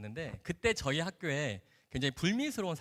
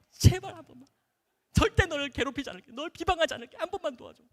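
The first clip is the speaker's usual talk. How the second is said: high-pitched, loud begging; acted-out frantic plea; desperate mock pleading; 12 semitones higher, 7 dB louder than usual. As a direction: plead like a whole desperate class, hammed up. Retold classmates begging her for help.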